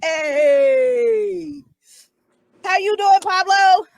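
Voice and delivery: high, loud, bright-eyed hello; hyped greeting. Real, not acted. Host greeting Pablo on the podcast, loud excited hello. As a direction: greet him like you haven't seen him in ages.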